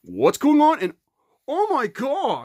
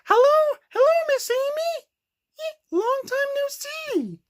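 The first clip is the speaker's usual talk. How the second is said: put-on much higher, excited little girl greeting; squeaky girl voice; 12 semitones higher than usual. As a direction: squeal the hello up high. Girl character greets Amy excitedly.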